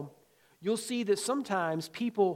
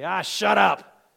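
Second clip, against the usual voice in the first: grandpa's gruff brush-off; 9 dB louder than usual. Retold or imitated. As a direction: bark it out like a no-nonsense grandpa. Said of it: "he'd say".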